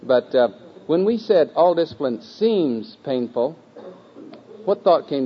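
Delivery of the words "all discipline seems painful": slow, seems drawn out and swooping; single out seems with a long, high stretch; stress on seems. Shown as a demonstration. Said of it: "Emphasizing that one word".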